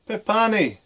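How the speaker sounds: high, loud apology called out; a father's loud, high apology; father shouting. A narrator's reading. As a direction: cry out the apology. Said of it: "his father shouted".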